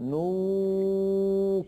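long held "نو" on a high level note, free of nasal hum; pure vowel, no ghunna. Shown as a demonstration. Teacher explains the sound, chanting "نو" without nasality. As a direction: sustain "نو" as a clean chanted oo. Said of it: "هذا الصوت كله ليس فيه غنة".

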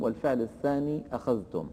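ordinary delivery

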